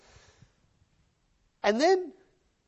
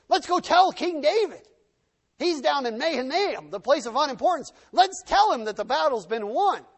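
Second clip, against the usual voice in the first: higher, louder, eager announcement; excited messenger; 7 semitones higher, 5 dB louder than usual. Acted. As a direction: bring the big news with urgency. Messengers eager to report the victory.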